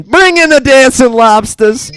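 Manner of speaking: bellowed sketch catchphrase; mock announcement. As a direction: call it out like a showman.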